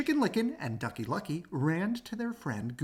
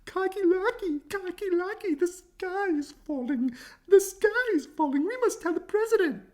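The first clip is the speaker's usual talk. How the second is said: much higher panicked hen cry; clucking hen; 5 dB louder than usual. Acted. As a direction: squawk it out like a frantic chicken. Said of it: "and yelled".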